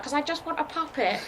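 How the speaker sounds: high, laughing director voice; impatient director. Retold or imitated. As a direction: toss it off like a fed-up director. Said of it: "they're like".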